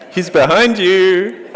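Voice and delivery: high, slow pantomime shout; panto crowd callback. Pretend. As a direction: call it out like a panto audience. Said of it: "that was a bit like pantomime".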